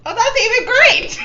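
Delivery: high, loud burst of glee; real squealed delight; gleeful exclamation. Real, not acted. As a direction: blurt it out high and laughing. Player reacts to sleeping creature.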